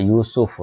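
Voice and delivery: यूसुफ as yoo-suf, short u after s; correct pronunciation demo. Shown as a demonstration. Teacher showing the vowel marks, careful demo of यूसुफ as yoo-suf. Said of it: "सीन के ऊपर पेश है".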